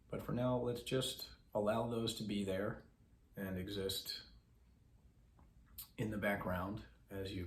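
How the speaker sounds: soft, measured voice with long pauses; guided meditation. Acted. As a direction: guide listeners gently into breath awareness.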